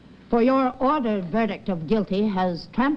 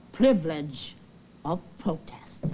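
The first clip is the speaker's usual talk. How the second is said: fading to a whisper; 7 semitones lower, 6 dB quieter than usual. Acted. Speech sinks to near whisper.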